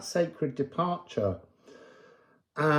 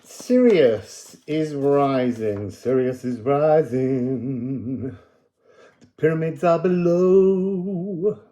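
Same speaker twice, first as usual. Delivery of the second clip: oracle pronouncement; 4 dB louder, slower than usual. Acted. Channelled message, slow and loud.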